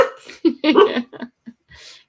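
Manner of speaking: bright high laugh breaking out; high burst of laughter. Real, not acted. Host cracking up at a viewer's word, laughing loud. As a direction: let a big laugh burst out.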